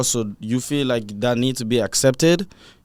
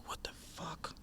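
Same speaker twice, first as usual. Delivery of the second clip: whispered quote; 25 dB quieter than usual. Retold or imitated. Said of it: "live reaction of these man like".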